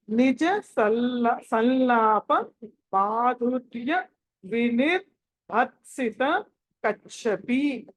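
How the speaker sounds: slow, word by word with long vowels; measured syllables, a gap after each word; slow model reading. Shown as a demonstration. Teacher modelling a verse line, slow word-by-word recitation. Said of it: "ఎలా పలకాలో చెప్తాను".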